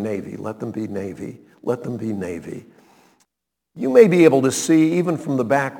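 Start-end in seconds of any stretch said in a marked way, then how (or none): 0.0-2.7 hushed hoping. Retold or imitated. Hoping shoes aren't purple.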